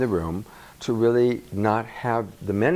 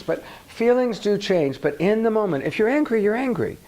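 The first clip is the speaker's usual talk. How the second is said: emphatic; 10 semitones higher, 4 dB louder than usual. Shown as a demonstration. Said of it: "emphatic angry example".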